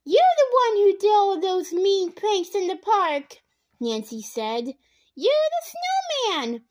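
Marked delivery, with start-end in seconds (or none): 0.0-3.4 accusing. A narrator's reading. Nancy accusing Bradley. 5.1-6.6 accusing. A narrator's reading. Nancy accusing Bradley.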